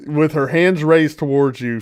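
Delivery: ordinary talk, nothing marked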